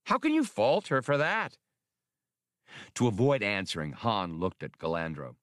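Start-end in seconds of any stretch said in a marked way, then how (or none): 0.0-1.6 raised, lightly voiced fussy official; a touch higher, precise and earnest; lightly voiced prim little official; Spray's voice. Spray speaks, voiced by narrator.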